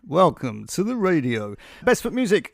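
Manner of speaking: loud, booming DJ voice; radio voice. Pretend. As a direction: ham up the radio host. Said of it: "I'll try not to put my radio voice on".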